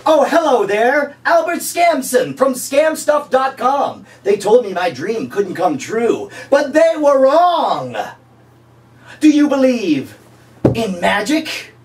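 high, slow, showy infomercial voice; cheesy pitchman. Pretend. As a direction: greet the viewer like a late night huckster.

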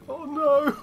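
dismayed squeal. Real, not acted. Diamond guarded over lava.

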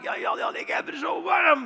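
high, loud, slowed whine; mock delirious wail. Hallucinating skater calls his roommate at night, loud mock whine. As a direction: call out like a feverish patient half laughing.

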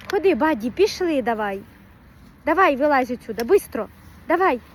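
loud, firm call to the dog; impatient loud call to a dawdling dog. Real, not acted. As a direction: raise the voice to make the dog come. Calling the dog to come.

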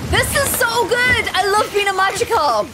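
delighted squeal. Real, not acted. Streamer loving being a Magikarp, delighted squeal. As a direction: gush with sudden glee. Delighted while swimming in the game.

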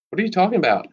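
high, over the others; voice rising. A narrator's reading. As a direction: rise above the room's noise. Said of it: "Maddie's voice rises above the rest".

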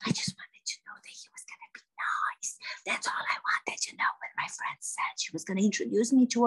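voiceless, confiding whisper; staged whisper. Acted. Actress explaining what she asked her friend, staged whisper. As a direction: whisper it as an aggrieved confession.

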